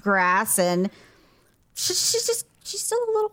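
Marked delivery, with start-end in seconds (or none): 1.7-3.3 words squeezed out high through laughter; laughing so hard the voice jumps up. Real, not acted. Owner laughs about her wandering cat, high giggly voice.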